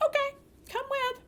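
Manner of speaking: high, falsely sweet; girl humoring her nosy sister; mock sweet. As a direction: play innocent and agreeable. Sister invites herself along.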